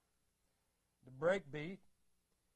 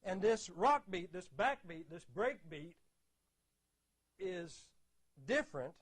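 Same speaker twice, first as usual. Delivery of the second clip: ordinary talk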